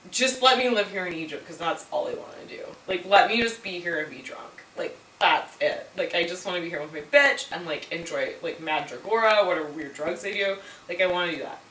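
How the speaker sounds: low, subdued mock pleading; defeated plea. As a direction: plead like someone who has given up. Acted plea of defeated Antony.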